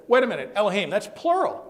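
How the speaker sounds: loud, high-pitched objection; indignant retold challenge. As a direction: interrupt the professor in disbelief. Retelling challenge to the professor.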